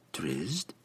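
soft questioning echo; curious echo. A narrator's reading. Lady surprised by the name, softly curious. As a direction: repeat it quietly, rising in curiosity. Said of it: "she echoed".

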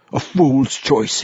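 lightly voiced dwarf cursing his own choice; self-reproachful dwarf. A narrator's reading. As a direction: draw it out with a touch of bitter regret.

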